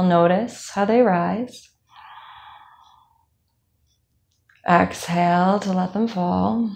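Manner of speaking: calm, even meditation voice with long pauses; guided meditation. Acted. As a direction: guide them unhurriedly through a body scan. Leads a body scan meditation.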